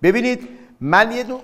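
usual speaking voice

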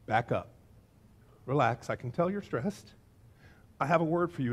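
low, soft reassurance; calm a stressed friend down gently; gentle calming. Acted. Jesus calms a stressed Martha, gentle and soft. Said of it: "simply and gently looks her in the eyes".

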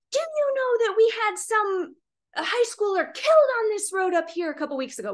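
high, indignant retold scolding; very upset. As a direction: imitate an upset neighbour driving a point home. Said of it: "he was very upset".

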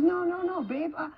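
high-pitched, hurried denial; retold protest. Wife brushing off her husband's praise, high-pitched protest.

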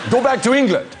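high-pitched retold heckle; mock angry jeer. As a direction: imitate an irate crowd sending someone packing. Angry Germans hurling abuse, retold.

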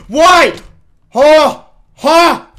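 high, very loud yelps; shocked outcry. Real, not acted. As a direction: yell in baffled disbelief.

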